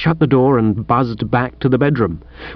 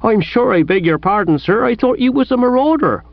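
higher-pitched apologetic constable; 9 semitones higher than usual. A narrator's reading. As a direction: beg pardon in a raised, anxious-to-excuse voice. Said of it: "He was in an apologetic vein".

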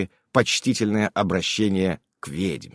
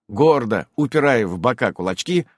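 ordinary delivery, nothing marked

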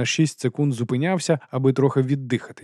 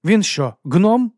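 incredulous question, pitched high and loud; jaw-dropped disbelief, voice leaping up; dumbfounded; 8 semitones higher, 6 dB louder, much slower than usual. Acted. Sergeant questions his captain about the dwarf, dumbfounded. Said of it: "у Колона відпала щелепа".

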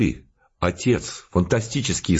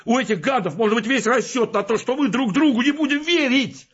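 high, frantic shouting rant; furious yelling. Acted. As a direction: yell it at him in a fury, pitch way up. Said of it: "Он так бешено орал и брызгался". (бешеный крик)

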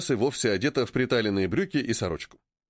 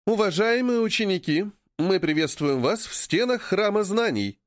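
raised, formal headmistress voice; stage address; 7 semitones higher than usual. A narrator's reading. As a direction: announce it grandly to the hall. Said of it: "вещает Руна со сцены".

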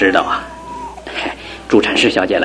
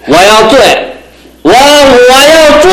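put-on drunken yell; 10 semitones higher than usual. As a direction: bellow it out drunk. Drunk husband yells defiantly.

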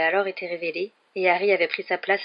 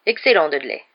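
hearty approval; 8 dB louder than usual. A narrator's reading. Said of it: "approuva l'oncle Vernon".

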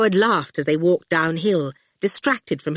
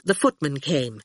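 ordinary delivery, nothing marked

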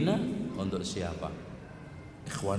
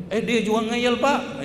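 put-on higher, louder tattling child; child accusing classmate to the teacher, higher and louder; complaining kid; 9 semitones higher, 4 dB louder than usual. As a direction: whine it like a kid telling on a friend.

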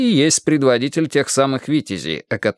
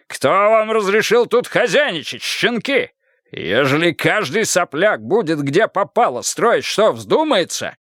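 high furious rant; 8 semitones higher, slower than usual. Acted. Karpov rants at the mayor.

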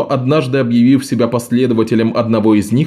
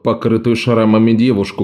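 ordinary delivery, nothing marked

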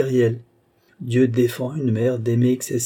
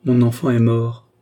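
louder, stark, cold pronouncement; coldly articulated; 5 dB louder than usual. A narrator's reading. Young mother realises her child is dead, coldly. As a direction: state it coldly, each word distinct. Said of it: "articula froidement la jeune fille".

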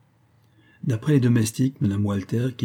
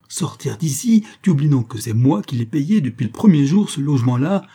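loud, high-pitched outburst of rage; explosive fury; 5 semitones higher, 6 dB louder than usual. A narrator's reading. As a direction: burst out at him, outraged. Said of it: "elle éclata". (explosion de colère)

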